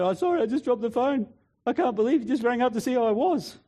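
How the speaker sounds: high, tearful mock voice; imitated crying. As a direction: act choked up with grateful tears on the phone. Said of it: "and she's crying".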